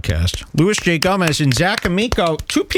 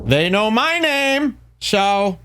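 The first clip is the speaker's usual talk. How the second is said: "my name" said much higher and slowly, starstruck; mock awed high repeat; 7 semitones higher, much slower than usual. Shown as a demonstration. Host repeating the line about his name, slow, high and mock-starstruck. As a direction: stretch it out high like it's a big deal. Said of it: "repeated take".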